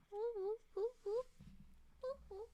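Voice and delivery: high sing-song whoop whoop; high whooping call. Pretend. Calling the little deer with a high whoop.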